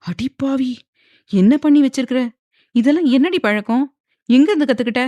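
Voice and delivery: furious mother's scolding; angry scolding. A narrator's reading. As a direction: tear into the girl as you smack her. Mother scolding while hitting her.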